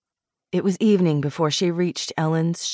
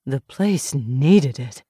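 put-on upcountry Southern accent; 3 semitones lower, 3 dB louder, much slower than usual. A narrator's reading. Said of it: "Missus Foster said".

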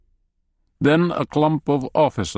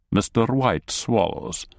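usual speaking voice

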